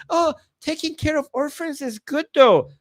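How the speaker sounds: high-pitched imitation of a naive defender; mock objector. Mimicking someone who defends the orphan verse, high mock objection. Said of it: "You're like".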